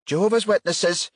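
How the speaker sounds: high, stern and dismissive woman. Acted. Woman sternly turning callers away, stern high voice. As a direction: shut the door on them with a stern word. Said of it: "the woman said sternly".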